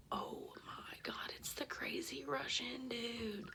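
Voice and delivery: slow, breathless whisper of alarm; shocked whisper. Retold or imitated. Whispering her shock on seeing the stranger at the door. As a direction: gasp it out under your breath.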